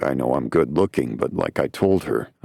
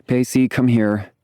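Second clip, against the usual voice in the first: higher, a touch impatient; annoyed; 8 semitones higher, 3 dB louder than usual. Acted. Winnie calling Pacey over to the phone, annoyed. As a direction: call him over, a little irritated. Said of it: "In an annoyed voice".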